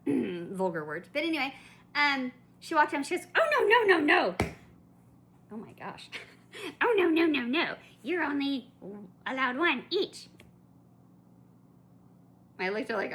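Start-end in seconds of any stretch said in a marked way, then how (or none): 3.3-4.3 high, loud sneering put-on lady voice; mocking rude lady. Customer mocks rude shopper scolding her, high and loud. 6.8-10.3 high, loud sneering put-on lady voice; mocking rude lady. Customer mocks rude shopper scolding her, high and loud.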